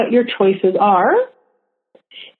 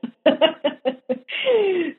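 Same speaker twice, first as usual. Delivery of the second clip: soft laugh; 6 semitones higher, 6 dB quieter than usual. Real, not acted. Laughs at calling it bullshit.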